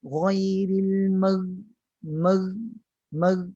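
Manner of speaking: MER closed on a held final gh, no bounce; no release. Shown as a demonstration. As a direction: hold the end of mer without a vowel after it. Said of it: "Maksudnya tidak terlepas". (mer ditahan tanpa qalqalah)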